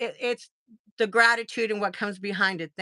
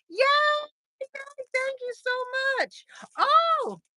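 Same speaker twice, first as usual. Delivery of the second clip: excited. Real, not acted. First super chat on stream.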